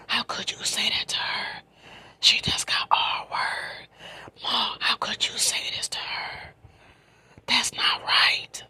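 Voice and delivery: fierce, voiceless stage whisper; breathy, urgent imitation of scolding; indignant retold protest. Retells cousin confronting her mother.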